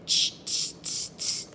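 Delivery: soft unvoiced tsh hisses; mock the peddler with hushed tsks; monkey tsk sounds. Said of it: "the monkeys ... said".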